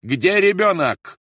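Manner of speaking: loud, high, slow demanding shout. A narrator's reading. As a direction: yell for the child. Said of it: "закричал Рольф". (громкий крик)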